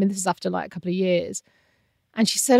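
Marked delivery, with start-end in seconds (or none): none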